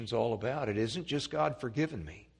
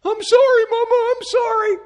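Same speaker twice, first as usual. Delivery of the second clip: put-on much higher, louder pleading child voice; sorry little boy; 14 dB louder than usual. Muddy little boy pleading with his mama, high whiny apology. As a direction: beg like a small boy caught out. Boy begs mother after mud.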